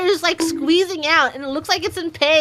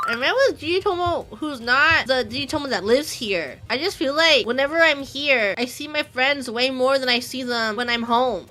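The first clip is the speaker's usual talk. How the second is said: ordinary talk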